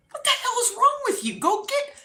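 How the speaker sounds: high, fast, exasperated scolding; mock exasperated outburst; exasperated mom. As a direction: imitate a fed-up mom yelling in one quick burst. Mom snapping at her in retold quarrel.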